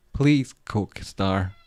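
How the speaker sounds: drawn-out plea. Real, not acted. Scolding the cat.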